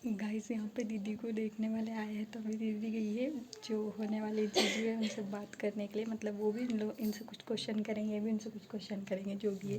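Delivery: speaking softly so the people nearby don't hear; hushed voice. Real, not acted. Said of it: "अभी मैं धीरे धीरे बोल रही हूँ". (दबी आवाज़ में, धीरे-धीरे)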